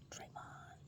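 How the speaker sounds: breathy whispered aside. Real, not acted. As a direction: drop to a bare whisper for the name. Aside after naming Draymond.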